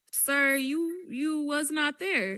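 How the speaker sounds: high-pitched, disbelieving comeback; indignant retort. Retold or imitated. Answering his claim about what was said, indignant. Acted retort to the retold caller.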